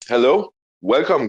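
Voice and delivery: usual speaking voice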